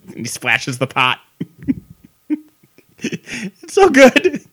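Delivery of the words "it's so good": high, loud, drawn-out gushing; gleeful delight. Real, not acted. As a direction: squeal it slowly, tickled by your own impression. Cracking up at own impression.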